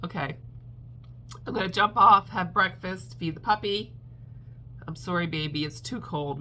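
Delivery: ordinary delivery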